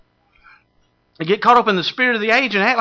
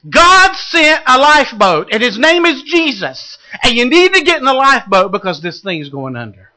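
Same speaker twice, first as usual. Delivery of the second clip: high, loud proclaiming; fervent preaching; 5 semitones higher, 6 dB louder than usual. Acted. Preacher proclaiming Jesus as the lifeboat, loud and fervent. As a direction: preach it with fire.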